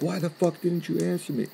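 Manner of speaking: high-pitched annoyed demand; imitated pissed-off roommate. Roommate confronts friend who didn't answer, mildly imitated anger. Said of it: "he's kinda pissed off".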